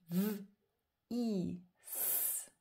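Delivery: V, I, S as three separate sounds. Shown as a demonstration. Teacher showing the three sounds of vis, sound by sound. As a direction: buzz V, hold I, hiss S. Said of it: "J'ai trois sons".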